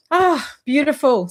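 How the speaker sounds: light up at the beauty; a real burst of delight; delighted sigh. Real, not acted. Marvelling at rainbows in clouds.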